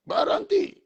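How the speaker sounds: high sharp command to stop; retold yell. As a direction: bark stop at the crowd. Mob shouting at the service.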